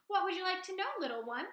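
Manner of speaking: welcoming the gosling; lightly voiced Loudwing. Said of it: "said Loudwing".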